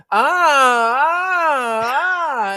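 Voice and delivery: high loud panicked wails; mock panicked wailing. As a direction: cry out in blind panic. Blind wife panicking.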